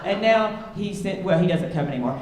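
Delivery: ordinary delivery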